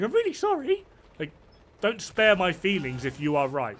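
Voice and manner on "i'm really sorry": very high, whiny mock sorry; mocking fake apology. Mocking a friend's overly polite apology, very high. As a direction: squeak it like a simpering apologizer. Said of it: "So instead of going".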